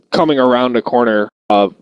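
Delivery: ordinary delivery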